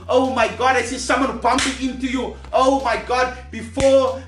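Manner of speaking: usual speaking voice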